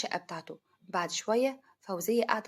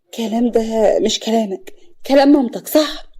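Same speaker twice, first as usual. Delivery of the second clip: loud, hurt protest; shocked and tearful; 9 dB louder than usual. A narrator's reading. Wife shocked at her husband's accusation, loudly hurt. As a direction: push back in shock, rising on the last words. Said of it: "خلود بتتصدم ودموعها بتلمع".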